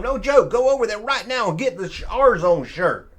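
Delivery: loud, high salesman pitch for the shirt; hyped merch ad. Acted. Vlogger pitching his shirt to viewers, hyped and loud. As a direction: sell it like a late-night ad.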